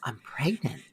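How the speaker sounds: whispered, soft and stunned; whispered, barely voiced reveal; quiet whisper. Shown as a demonstration. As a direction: breathe it out quietly in awe. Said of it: "really kinda quietly just goes".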